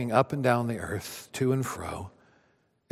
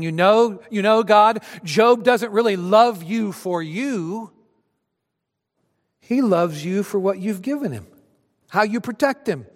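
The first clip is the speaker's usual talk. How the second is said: higher, louder sneering accuser; a hint of a scheming Satan; voicing Satan; 7 semitones higher, 4 dB louder than usual. Preacher voices Satan's accusation.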